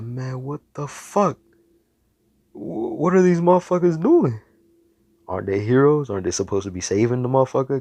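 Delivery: high, loud indignant outburst; outraged disbelief. Acted. Hero sees the other heroes doing nothing, outraged disbelief.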